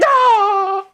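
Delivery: high, loud cry then slow whine; hammed-up despair watching your video back; mock despair. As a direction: wail at your own video like a hurt kid. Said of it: "and you're like".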